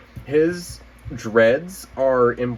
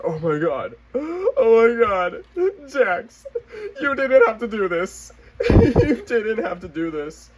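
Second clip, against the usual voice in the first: high, loud laughing-crying gush; high laughing squeal of delight; overjoyed squeal; 13 semitones higher, 3 dB louder than usual. Retold or imitated. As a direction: squeal through laughter like it's a gift. Overwhelmed joy at the prototype.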